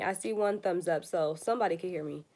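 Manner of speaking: normal talking voice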